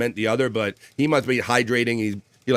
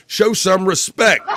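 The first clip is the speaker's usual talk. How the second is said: laughing shout; 7 semitones higher, 6 dB louder, slower than usual. Acted. Reading chat comment, laughing shout.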